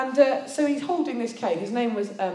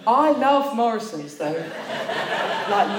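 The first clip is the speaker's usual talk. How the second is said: laughing aside; 5 dB louder, much slower than usual. Real, not acted. Aside about preferring Morrisons.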